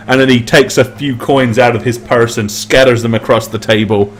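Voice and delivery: ordinary talk, nothing marked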